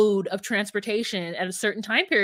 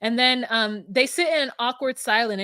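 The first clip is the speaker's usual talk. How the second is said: ordinary talk, nothing marked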